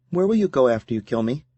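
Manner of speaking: put-on a curious young woman asking; Serena voice. A narrator's reading.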